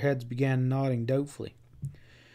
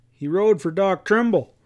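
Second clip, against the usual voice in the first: louder and higher, pitched to carry; explaining loudly; 6 semitones higher, 6 dB louder than usual. A narrator's reading. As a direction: say it loud so someone inside hears. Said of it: "Pat explained loudly".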